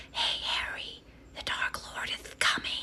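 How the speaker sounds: hushed, breathy-whispered little snake; whispering snake. Acted. Pet snake warns Harry of the Dark Lord, whispering.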